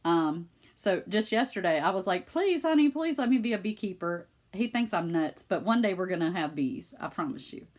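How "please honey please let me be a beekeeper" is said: high start, mock begging; wheedle, starting high then easing down; playful pleading. Wife asking husband for bees, playfully pleading.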